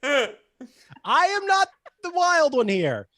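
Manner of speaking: loud, high hammed-up outburst; big, over-the-top denial; indignant protest. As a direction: shout it in comic indignation. Protesting being called dramatic.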